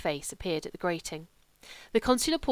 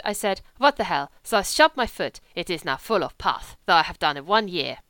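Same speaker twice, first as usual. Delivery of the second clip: put-on louder, lightly accented young German; a hint of a lazy wolfish German drifter; German legionnaire; 6 dB louder than usual. German voiced in the story.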